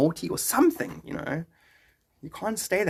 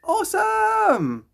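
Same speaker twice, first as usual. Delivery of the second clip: very high, slow and stretched; long squealed awesome; drawn-out excited cheer; 3 dB louder, much slower than usual. Real, not acted. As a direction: sing it out with delight. Excited reaction to a viewer.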